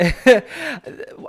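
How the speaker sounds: high, loud real laughter; burst of laughter. Real, not acted. Remembering grandma's teeth, real laughter. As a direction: crack up at the memory.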